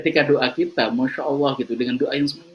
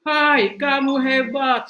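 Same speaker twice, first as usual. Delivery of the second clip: high, loud, slow shout of praise; father shouting; 13 semitones higher, 7 dB louder, much slower than usual. Acted. A father on the mountain calls out loud, slow praise to the echo. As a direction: call out the praise across the valley. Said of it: "Sang ayah teriak".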